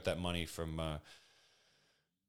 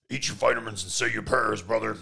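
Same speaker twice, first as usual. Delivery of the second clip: loud, higher wrestler bellow ending on "brother"; Hulk Hogan voice; 4 semitones higher, 10 dB louder than usual. Retold or imitated. Host mocks the wrestler's catchphrase for listeners, in a loud Hulk Hogan bellow. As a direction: boom it out like a pumped-up wrestling promo. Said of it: "Sorry, I'm not doing the Hulk Hogan voice".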